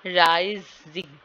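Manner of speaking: Rising slowly split, राइ drawn out and stressed; stress on first syllable. Shown as a demonstration. Teacher repeating the example of pitch movement, stressed राइ in rising. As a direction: stretch राइ before a separate Jing. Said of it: "इसकी जो pitch है वो ज़्यादा है".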